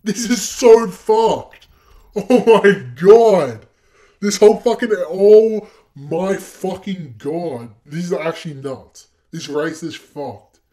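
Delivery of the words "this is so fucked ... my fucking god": slow, louder and higher exasperated shouting; frustrated yell. Real, not acted. Gamer shouts after failing the race yet again. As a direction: drag the words out in fury.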